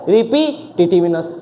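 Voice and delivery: रिपीटेटिवनेस said slowly as ri-pi-ti-tiv-ness; model pronunciation. Shown as a demonstration. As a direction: pronounce रिपीटेटिवनेस slowly for students. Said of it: "इसकी pronunciation यही होती है".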